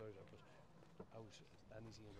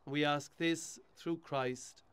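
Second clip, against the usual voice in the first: steady, solemn prayer reading; hushed graveside prayer; 6 semitones higher, 23 dB louder, slower than usual. Acted. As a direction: lead the mourners in prayer. Priest leads graveside blessing.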